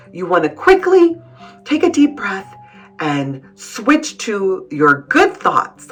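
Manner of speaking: ordinary delivery, nothing marked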